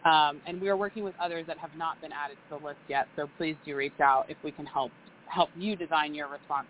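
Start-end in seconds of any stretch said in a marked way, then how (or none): none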